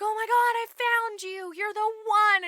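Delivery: high, gushing discovery; mock overjoyed prince. Prince believes he found the one, gushing high. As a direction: exclaim it like a lovestruck prince.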